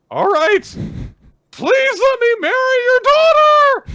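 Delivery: very high, loud, drawn-out cry; acted all-out plea for her hand; tense shouted plea. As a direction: shout it out with desperate formality. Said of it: "the tension in his voice".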